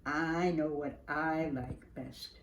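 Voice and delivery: plain reading voice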